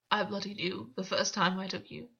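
ordinary delivery, nothing marked

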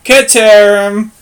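Ceterum slow and loud, "KÆ-te-rum"; exaggerated pronunciation. Shown as a demonstration. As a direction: hammer out Ceterum slowly with a hard k.

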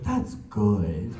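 low, understated deadpan approval; dry grandfather. Retold or imitated. Grandfather hearing of plans with friends, dryly. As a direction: lightly imitate a grandfather's dry humor. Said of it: "he's dry humor too".

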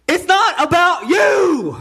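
loud, high, slowed preaching shout; emphatic shout. Real, not acted. Preacher shouting to the congregation it's not about him, loud shout. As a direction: bellow it at the room.

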